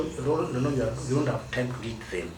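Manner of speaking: ordinary talk, nothing marked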